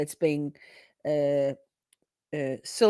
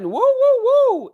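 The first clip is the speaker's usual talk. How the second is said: much higher, much louder woo woo woo; excited whoops; 11 semitones higher, 9 dB louder, much slower than usual. Real, not acted. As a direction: whoop like a crowd.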